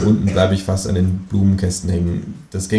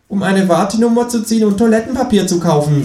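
high, mocking kangaroo; mock him as the kangaroo; 13 semitones higher, 5 dB louder than usual. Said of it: "sagt das Känguru". (hohe, spöttische Känguru-Stimme)

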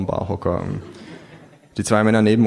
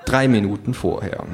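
ordinary delivery, nothing marked

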